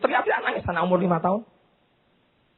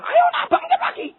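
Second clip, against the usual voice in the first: high encouraging call. Retold or imitated. Mother encouraging fallen child.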